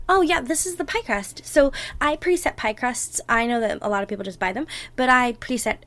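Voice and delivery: normal talking voice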